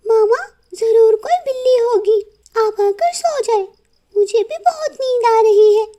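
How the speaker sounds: put-on high, childlike chirp; high little daughter's voice; little girl voice. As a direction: play the small daughter bird.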